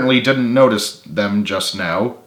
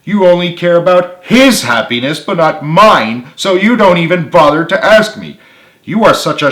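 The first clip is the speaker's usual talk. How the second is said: higher, louder hurt complaint; upset accusation; 7 semitones higher, 6 dB louder than usual. A narrator's reading. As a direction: accuse them, voice rising. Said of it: "retorted Carly with awful, full sarcasm".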